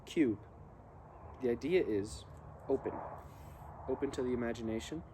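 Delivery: usual speaking voice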